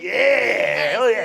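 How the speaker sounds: a laid-back surfer werewolf yelling hey; hyped werewolf bro; party werewolf dude. Acted. Werewolf stoner voice.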